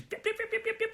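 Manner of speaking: high, squeaky mock yaps; imitated dog barking. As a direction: yap in quick high beeps like a dog. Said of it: "barking at me".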